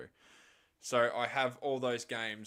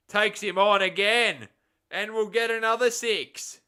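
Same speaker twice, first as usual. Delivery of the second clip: loud, much higher shouted call of a six; excited shout; 10 semitones higher, 14 dB louder than usual. Real, not acted. Commentator calling another six for Nepal fans, excited shout. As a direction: erupt as the ball sails for six. Six being hit live.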